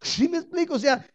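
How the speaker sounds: ordinary talk, nothing marked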